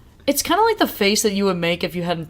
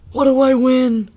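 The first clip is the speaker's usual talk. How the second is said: loud mocking quote; brash teasing shout; mock Izzy voice; 6 semitones higher, 5 dB louder than usual. Izzy's mocking quote from show.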